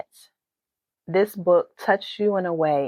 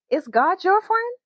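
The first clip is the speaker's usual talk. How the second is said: put-on high, hopeful child asking; small girl's question to Grandma, high and eager; little girl voice; 11 semitones higher than usual. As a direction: ask it like a curious little girl. Talia asks Grandma.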